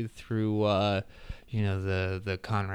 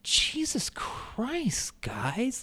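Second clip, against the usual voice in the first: exasperated; 12 semitones higher, 6 dB quieter, much slower than usual. Retold or imitated. Exasperated outburst about repeated matches.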